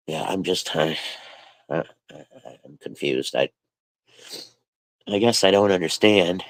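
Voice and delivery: barely holding it together, real tears close; choked up, near tears. Real, not acted. Streamer confessing hurt to her chat, quietly choked up. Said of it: "I'm making myself cry".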